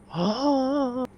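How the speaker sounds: high, sudden intake of breath; sharp gasp. Real, not acted. Sudden gasp, then apologises.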